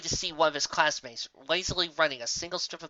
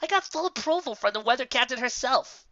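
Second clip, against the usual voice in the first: smug grin; 10 semitones higher than usual. Acted. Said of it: "obnoxious grin imaginable plastered across her face".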